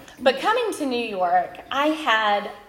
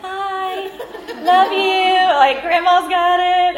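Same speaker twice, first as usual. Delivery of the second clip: put-on high, loud, sung-out bye to the kids; chirpy parent brushing off a crisis, much higher and louder; breezy singsong goodbye; 10 semitones higher, 11 dB louder, slower than usual. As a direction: toss it off cheerfully in a singsong. Hurried goodbye to bleeding child.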